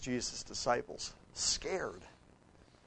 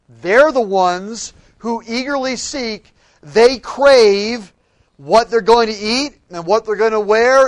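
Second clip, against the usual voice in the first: preaching at full force; 8 semitones higher, 15 dB louder than usual. Acted. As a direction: drive the point home to the congregation.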